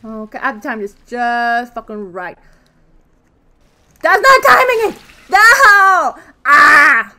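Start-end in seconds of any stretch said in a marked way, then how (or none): 4.0-7.1 high, loud protest at the screen; frustrated yelling. Real, not acted. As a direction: yell at yourself for missing. Mistimed jump, character dies.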